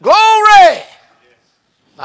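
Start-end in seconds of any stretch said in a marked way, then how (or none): 0.0-0.8 high, loud cry of glory; shouted praise. Real, not acted. Preacher cries out praise to the congregation, high and loud. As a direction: belt it to the rafters.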